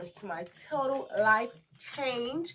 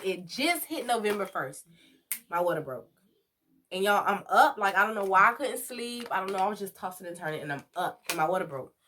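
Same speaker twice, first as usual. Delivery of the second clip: ordinary delivery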